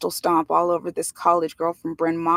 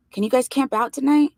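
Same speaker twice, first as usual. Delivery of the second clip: earnest excitement; 6 semitones higher, 4 dB louder than usual. A narrator's reading. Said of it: "looking at us earnestly, excitedly".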